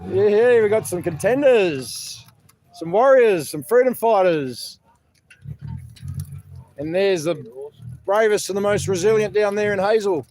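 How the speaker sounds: bigging up the arrivals like a boxing announcer; loud, playful fight-night introduction; mock announcer hype. Hyping arriving mates like fighters.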